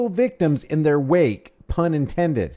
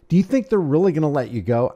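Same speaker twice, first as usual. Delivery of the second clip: ordinary talk, nothing marked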